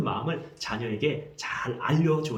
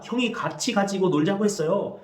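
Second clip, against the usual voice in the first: a hint of a younger brother's voice, higher; younger brother's complaint; 7 semitones higher than usual. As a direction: report what the older brother said, childlike. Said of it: "동생이 말합니다".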